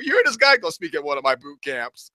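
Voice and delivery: lightly voiced mocking friend; a hint of a friend scoffing. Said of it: "This is what he's saying to people".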